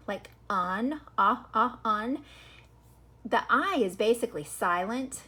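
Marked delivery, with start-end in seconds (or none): 0.1-2.2 "on" loud, its vowel repeated alone as "ah, ah"; isolated vowel sound. Shown as a demonstration. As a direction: sound out the vowel of "on" in separate bursts. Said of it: "you mostly hear the O".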